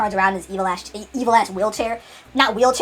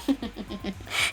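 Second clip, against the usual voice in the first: menacing giggle; 9 dB quieter than usual. Retold or imitated. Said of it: "he giggles menacingly".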